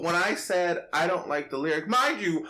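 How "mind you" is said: usual speaking voice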